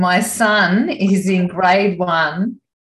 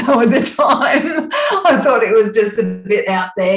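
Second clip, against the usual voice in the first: louder, higher words through laughter; laughing; 5 semitones higher, 5 dB louder than usual. Real, not acted. Telling the interviewer about the odd man, laughing.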